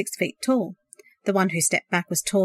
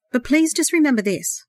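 pitched up, louder plea; loud imploring; 6 semitones higher than usual. A narrator's reading. Brother implores his brother to remember, loud plea. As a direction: press the plea home with more voice. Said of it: "the earnest voice implored loudly".

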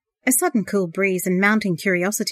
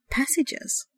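soft unvoiced puzzled whisper; whispered wondering; 8 semitones higher, 5 dB quieter than usual. A narrator's reading. Sandra wonders to herself behind the wall, hushed whisper. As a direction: breathe the word like a private puzzle. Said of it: "Sandra wondered".

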